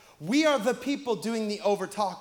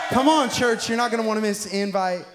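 rallying shout to church; 6 dB louder than usual. Acted. Rallying church for Invite Sunday.

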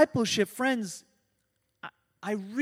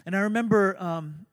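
normal talking voice

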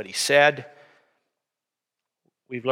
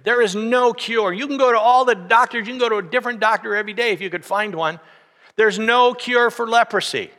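higher and louder, driving each "no cure" home; full-force preaching; 6 semitones higher, 5 dB louder than usual. Acted. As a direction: preach it with rising urgency. Preaching hard on leprosy.

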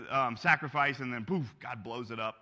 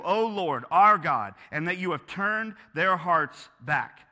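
louder, earnest call to God; fervent prayer; 4 semitones higher, 7 dB louder than usual. Acted. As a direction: pray out boldly before the crowd. Elijah prays aloud for fire.

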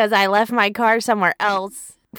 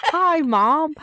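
lightly put-on higher voice; a hint of a movie star greeting; Julia Roberts impression; 6 semitones higher than usual. Daughter greeting mom as a lightly voiced Julia Roberts. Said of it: "that does not sound like you".

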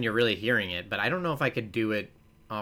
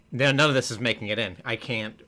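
ordinary talk

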